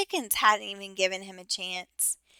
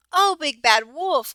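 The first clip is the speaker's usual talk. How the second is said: crying out; 5 semitones higher, 5 dB louder than usual. A narrator's reading. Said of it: "cried Missus Duck".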